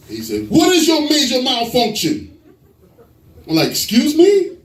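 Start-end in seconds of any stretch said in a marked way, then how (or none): 0.5-2.4 high, slow indignant demand; drawn-out, high-pitched officer's challenge; pissed-off state trooper. Acted. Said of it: "you know they pissed off". 3.7-4.6 taken aback. Acted. Startled driver answers the trooper.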